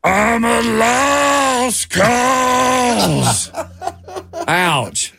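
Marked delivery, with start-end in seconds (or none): 0.0-3.5 long, drawn-out high wail, straining the voice; hammed-up, throat-straining country-star howl. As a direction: belt it out as a bad celebrity impression. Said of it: "do an imitation of them".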